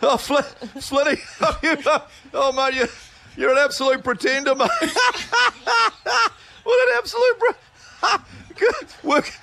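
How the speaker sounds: high cackling laughter through the words; performed laugh. Pretend. [name] laughing at Flinny for the laugh-off, high cackling laughter. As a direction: laugh hard on every word, squealing high. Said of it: "It's [name]'s turn to laugh".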